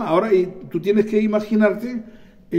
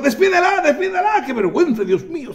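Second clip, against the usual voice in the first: high-pitched mock indignation; hammed-up scandalized complaint, falling off at the end; exasperated disciples; 10 semitones higher than usual. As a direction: squawk it like embarrassed followers, half laughing. Disciples voiced as exasperated by the woman.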